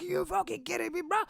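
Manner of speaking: a high squeak of outrage; disbelief. Retold or imitated. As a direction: react to the fifty pence charge. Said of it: "And I was like".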